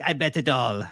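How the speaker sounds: hammed-up bad Russian accent; terrible Russian accent. Quoting John Malkovich's poker player in Rounders, terrible Russian accent. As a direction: do a clumsy mock Russian accent. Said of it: "Terrible Russian accent".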